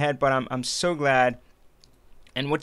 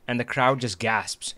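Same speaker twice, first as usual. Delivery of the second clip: normal talking voice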